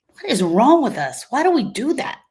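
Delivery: ordinary delivery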